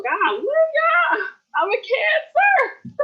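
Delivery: very high, loud and slow, voice breaking; emotional outburst. Real, not acted. A Cancer admitting to her viewers she's overcome, high emotional cry. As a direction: cry it out high and loud, half laughing at herself. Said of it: "Y'all seeing the Cancer crab come out".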